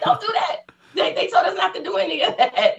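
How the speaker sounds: high-pitched scolding through laughter; amused, squeaky mock reprimand; laughing retelling. Real, not acted. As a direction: giggle while re-enacting a panicked telling-off. Laughing while retelling scolding her sister.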